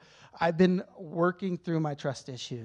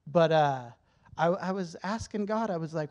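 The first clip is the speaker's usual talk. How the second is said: ordinary talk, nothing marked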